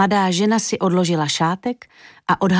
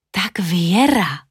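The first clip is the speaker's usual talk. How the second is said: slow, whispered musing; drawn-out private whisper; to herself; much slower than usual. A narrator's reading. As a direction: whisper it slowly to yourself. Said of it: "řekla si pro sebe Julinka".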